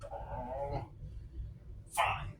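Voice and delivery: grudging cartoon octopus; Squidward. Squidward reluctantly letting his neighbor help, grudging impression. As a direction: give in wearily, in a put-on character voice. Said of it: "this is Squidward Tentacles".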